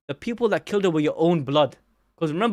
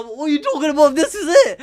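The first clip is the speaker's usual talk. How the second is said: excited; 9 semitones higher, 4 dB louder than usual. Real, not acted. Hyped about proving a fan theory.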